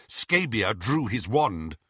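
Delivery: plain reading voice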